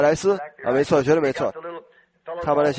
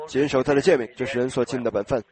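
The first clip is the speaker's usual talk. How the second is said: normal talking voice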